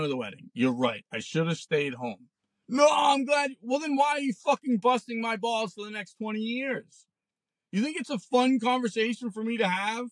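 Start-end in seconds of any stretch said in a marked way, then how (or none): 2.7-3.6 higher, louder imitation of the buddy; mock shouted retort. Buddy's retort, retold quarrel. 3.6-9.6 real exasperated shouting; angry shout. Real, not acted. Ranting back at former buddy.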